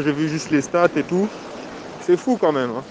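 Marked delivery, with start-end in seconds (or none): none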